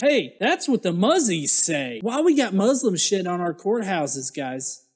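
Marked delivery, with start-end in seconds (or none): none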